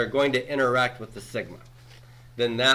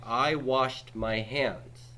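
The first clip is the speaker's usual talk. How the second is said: slow, deliberate example read; careful classroom example; slow example sentence; 2 semitones higher, much slower than usual. Shown as a demonstration. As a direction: spell it out slowly for the class. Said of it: "if I wanted to say something like".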